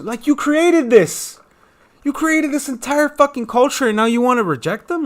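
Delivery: real angry shout, high and loud; getting mad. Real, not acted. Vlogger accusing America of rejecting its culture, angry shouting. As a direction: yell it in outraged accusation. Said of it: "I don't know why I'm getting mad this way".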